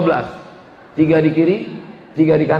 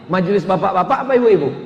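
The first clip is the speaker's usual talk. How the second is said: ordinary delivery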